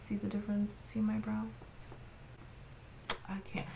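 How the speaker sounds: fast conspiratorial whisper. Real, not acted. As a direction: lean in and whisper. Whispered question to viewers.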